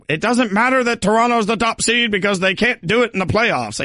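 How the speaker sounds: high-pitched mocking pundit voice; mock debater. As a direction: parody a worked-up sports-radio argument. Mimics fans' coming playoff debate.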